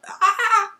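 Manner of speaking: high, loud shriek of fright while laughing; a short, high laughing scream. Retold or imitated. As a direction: squeal like the book just scared you. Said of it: "I'm like".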